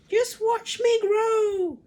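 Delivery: put-on very high, slow, loud screeching old woman; screechy crone shout. Grandma screeching at George to watch her grow, gleeful. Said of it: "she shouted".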